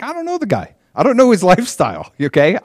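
high-pitched, hammed-up exasperation; exasperated. Healed man questioned by the Pharisees, exasperated. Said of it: "he's exasperated".

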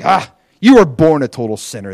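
loud, frustrated outburst. Retold or imitated. Pharisees out of arguments, frustrated. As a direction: burst out with a forceful insult. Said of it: "they're just frustrated".

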